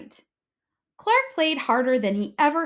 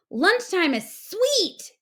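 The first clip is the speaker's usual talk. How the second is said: yelled; 10 semitones higher, 4 dB louder, slower than usual. A narrator's reading. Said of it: "yelled Clark the shark".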